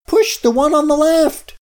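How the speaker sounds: high, urgent shout; urgent order. A narrator's reading. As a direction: squeal the instruction in a hurry. Urgent order in runaway truck.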